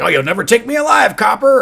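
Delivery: high, loud, fast defiant yell; hammed-up movie villain cry, high and loud; mock villain shout. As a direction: shout it like a cornered gangster. Villain quote while retelling film.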